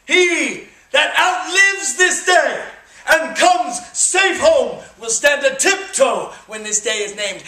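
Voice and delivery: stirring theatrical recital of Henry the Fifth; rally the troops like a stage king; Shakespearean speech. Acted. Performs Henry's Crispin speech.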